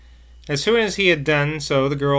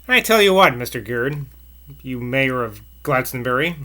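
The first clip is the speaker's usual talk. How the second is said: usual speaking voice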